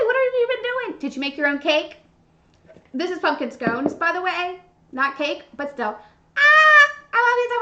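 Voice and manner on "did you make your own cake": ordinary delivery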